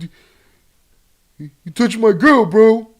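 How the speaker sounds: loud, high street-tough voice; wounded thug hollering; gangster voice. Retold or imitated. Acted quarrel between two gangsters.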